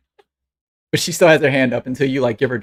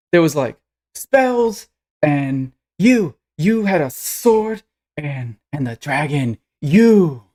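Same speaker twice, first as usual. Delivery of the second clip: put-on awestruck little girl; slower than usual. Young fan recounts the fight to her heroes, awestruck. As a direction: tumble through the highlights in awe.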